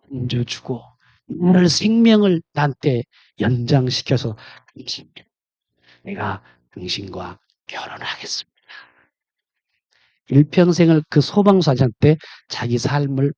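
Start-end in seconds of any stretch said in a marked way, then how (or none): 4.8-8.6 soft, breathy whisper of a grateful vow. Retold or imitated. Young woman vowing marriage to the firefighter, whispered. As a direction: breathe the promise barely voiced.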